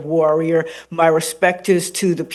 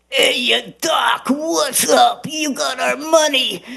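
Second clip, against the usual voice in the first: higher, slow, strained alien shout; alien Markmen voice; 7 semitones higher, slower than usual. Shown as a demonstration. Markmen aliens shaking down Gnisi for money, higher slow strained shout. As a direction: bellow like a shakedown alien thug. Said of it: "that voice I have to watch".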